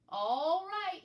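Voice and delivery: calling back. Acted. Henry answers from the box.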